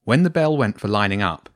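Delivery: plain reading voice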